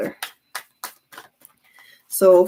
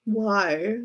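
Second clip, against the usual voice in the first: usual speaking voice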